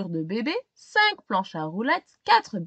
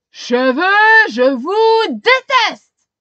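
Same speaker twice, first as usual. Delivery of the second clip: loud, high, slow furious yell; shouting; 9 semitones higher, 13 dB louder, much slower than usual. A narrator's reading. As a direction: drag out the hatred at full volume. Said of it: "crie le policier".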